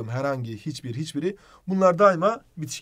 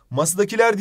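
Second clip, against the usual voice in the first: "Masadakiler" said higher and louder; test word called out; 6 semitones higher, 5 dB louder than usual. Shown as a demonstration. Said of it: "diyebiliyor muyuz?". (test kelimesini yüksek sesle söyleme)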